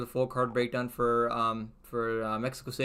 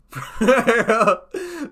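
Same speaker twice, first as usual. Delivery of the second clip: big burst of laughter; loud laugh; 9 semitones higher, 9 dB louder than usual. Real, not acted. Reacting to a chat message.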